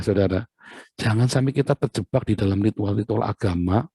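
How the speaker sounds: ordinary talk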